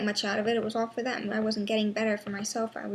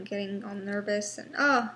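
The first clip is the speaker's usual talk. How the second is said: normal talking voice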